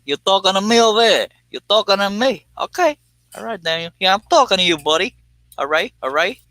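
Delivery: high-pitched, hammed-up "talking to me" routine; mock tough-guy challenge. Answering viewer Daniel's fed line, high mock tough-guy voice. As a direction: throw the challenge back in a comic high voice.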